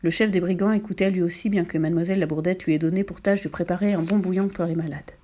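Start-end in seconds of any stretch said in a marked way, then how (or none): none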